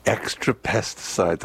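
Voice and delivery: slow, stressed "Extra pesticides" with EXTRA hit hard; disgusted emphasis. Real, not acted. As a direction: lean on "Extra" with distaste. Repeating the recall in disgust.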